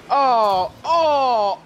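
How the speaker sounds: panicked excited yelp; rising excited cry. Real, not acted. Tense moment.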